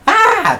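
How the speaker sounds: shrill high shriek; the platypus screams when touched. Acted. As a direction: let out a startled shriek. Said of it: "the creature screamed".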